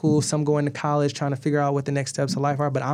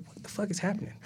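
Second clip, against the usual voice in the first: hushed panic; 6 semitones higher, 11 dB quieter, faster than usual. Retold or imitated. Alone, scared behind door.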